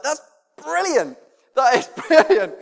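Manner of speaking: high, laughing exclamation; amused delight. Retold or imitated. Dad retells his reaction to daughter's confession, high amused delight.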